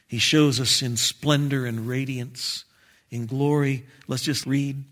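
ordinary talk, nothing marked

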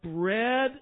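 very slow, drawn out word by word; slow solemn declaration. Acted. Preacher quoting Jesus declaring who he is, slow and solemn. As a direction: stretch out each word with weight. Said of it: "I wonder if he said it like that".

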